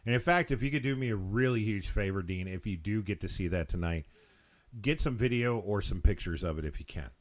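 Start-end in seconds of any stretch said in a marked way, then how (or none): none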